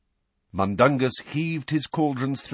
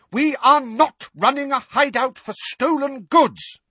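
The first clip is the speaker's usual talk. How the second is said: much higher, much louder furious yell; furious shout; 7 dB louder than usual. Acted. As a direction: scream it at the top of her voice. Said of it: "shouting at the top of her voice".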